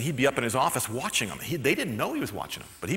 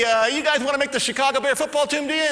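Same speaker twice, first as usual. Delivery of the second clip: loud, high taunting coach challenge; tough coach; 11 semitones higher, 4 dB louder than usual. Retold or imitated. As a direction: bark it at the rookies. Coach addresses rookies.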